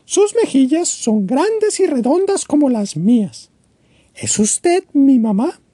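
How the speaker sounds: put-on high, small childlike bird voice; a tiny chick asking hopefully; little bird Choco. Choco asks the walrus. (pajarito Choco, voz aguda e infantil)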